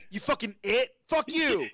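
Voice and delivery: high, loud hammed-up tirade; angry. Comic acting out yelling at random audience members, mock furious shout. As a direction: rage at them at full shout, pitch way up. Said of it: "so angry about celebrities".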